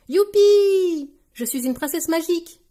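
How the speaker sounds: high, loud, drawn-out cheer; excited cry. A narrator's reading. Peppa crowned as a princess, crying out gleefully. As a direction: burst out like a delighted little girl. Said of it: "s'écrie Peppa".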